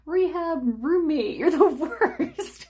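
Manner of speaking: high, loud mock wail, laughing through it; whiny hurt friend. Mocking a hurt friend who feels abandoned, high wail. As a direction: play the wounded friend crying you're the worst. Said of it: "And they go".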